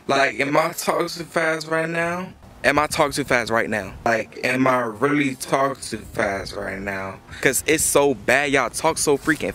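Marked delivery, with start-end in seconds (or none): 0.1-2.3 deliberately slowed. Shown as a demonstration. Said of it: "I try to slow it down sometimes". 4.1-7.2 drawn-out slow demo. Shown as a demonstration. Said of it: "I try to slow it down sometimes".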